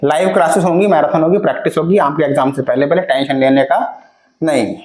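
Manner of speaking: ordinary talk, nothing marked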